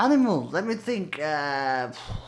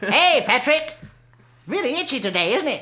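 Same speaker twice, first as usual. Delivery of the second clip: higher cartoon sponge greeting; lightly voiced SpongeBob; 6 semitones higher than usual. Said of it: "That's terrible".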